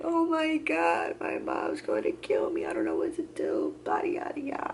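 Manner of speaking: high wail sinking into breathy whimpering; mock crying. Patient dreading her mom finding out, mock crying. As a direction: imitate bawling in a panic. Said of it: "Bawled out, crying".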